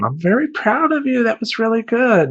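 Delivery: high, sweet praising voice; praising girl. Retold or imitated. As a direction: praise him like a child. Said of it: "The girl's going".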